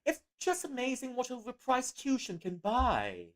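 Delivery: high, lofty mock-posh tone; hammed-up pretentious voice. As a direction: sound snooty on purpose. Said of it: "make this as pretentious as possible".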